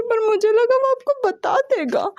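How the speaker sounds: put-on high, teary little girl voice; wiping tears, hurt and let down; a tearful young girl. A narrator's reading. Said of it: "आंसुओं को साफ करते हुए कहती है".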